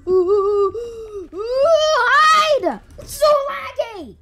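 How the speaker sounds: high rising panic shout; panicking gamer. Real, not acted. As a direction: scramble and yell. Panicking hiding.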